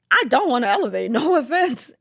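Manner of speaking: high, loud half-laughing protest; comic refusal. Retold or imitated. Telling friends at Chipotle she won't elevate, high laughing refusal.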